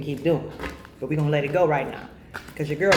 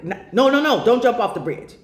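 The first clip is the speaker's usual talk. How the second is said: higher, louder urgent no; a loud, alarmed take-back; urgent correction; 5 semitones higher, 5 dB louder than usual. Real, not acted. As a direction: call it back fast before anyone jumps. Urgently takes back her own advice.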